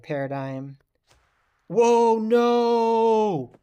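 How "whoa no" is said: high, loud cry of alarm; dismayed shout. Real, not acted. Player watching a bad throw, shocked shout. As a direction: yell as the throw goes wrong. Throw goes badly wrong.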